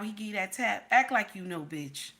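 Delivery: ordinary talk, nothing marked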